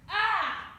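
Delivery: sudden high yelp of terror; scream of fright. Retold or imitated. Fleeing the teacher's dogs, a loud panicked shriek. Dogs come, she yelps.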